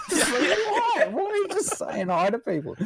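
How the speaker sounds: squeaky high laughing why; cracking up while asking why; laughing disbelief. Real, not acted. Laughing at the video.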